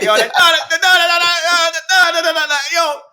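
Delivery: high, loud hyped MC stutter; revving up a sound clash crowd; imitating a sound clash MC. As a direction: hype the crowd like a dancehall MC. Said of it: "you remember he'd be like".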